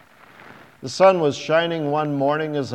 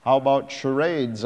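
sarcastic. A narrator's reading. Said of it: "I asked sarcastically".

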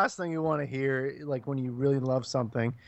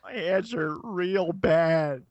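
very slow, drawn-out movie-villain whine; mock hurt pleading; much slower than usual. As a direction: stretch every word in hammed-up pain. Imitating hurt villain pleading from film.

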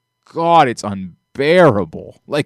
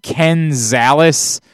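very slow, each name spelled out; pointed slow naming; much slower than usual. Real, not acted. Reminding rude tweeters they host a podcast, very slow and pointed. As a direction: lay it out slowly for the doubters. Stressing their names pointedly.

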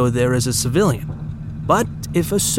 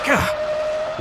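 startled yelp; 3 dB quieter than usual. Acted. Startled when Jethro vanishes.

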